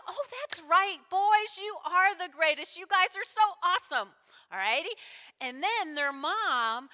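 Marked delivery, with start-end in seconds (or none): 0.1-4.1 higher, louder gushing praise; doting mom. Acted. Mom praising her two sons, gushing. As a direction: gush proudly like a mama over her boys. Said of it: "their mom is like".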